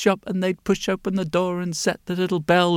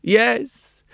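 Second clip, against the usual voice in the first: higher-pitched, strained character voice; troubled mole-like man; 7 semitones higher than usual. A narrator's reading. Mole-like man answering the glasses maker, troubled.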